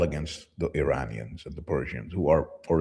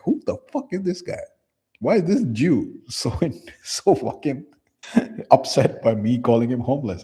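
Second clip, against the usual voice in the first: puzzled disbelief; 8 semitones higher, 4 dB louder than usual. Retold or imitated. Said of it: "You'll be like".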